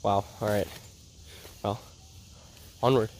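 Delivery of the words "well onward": ordinary delivery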